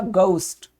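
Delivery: गोस्ट in a British accent with an ou glide; British गोस्ट, the o gliding to ou. Shown as a demonstration. Teacher demonstrating the British गोस्ट. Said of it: "British English में इसको बोला जाएगा".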